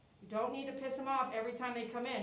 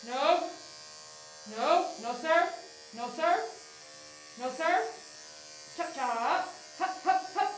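loud, raised no serve; firm commands; 4 semitones higher, 4 dB louder, slower than usual. Real, not acted. Groomer calling firm commands at a resisting dog, loud. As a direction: bark short orders at the dog.

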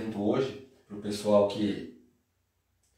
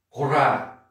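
confronting someone; 6 dB louder than usual. Shown as a demonstration. Guy confronting someone nearby, aggressive shout. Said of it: "o cara indo intimar alguém".

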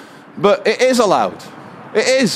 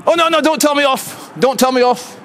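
high-pitched, insistent protest; pleading protest; 6 semitones higher than usual. Real, not acted. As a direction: fend off a telling-off, voice pitched up. Protesting at being told off by guard.

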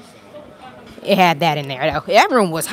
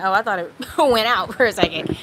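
laughing; 2 semitones higher than usual. Real, not acted. Laughs over phone glitch.

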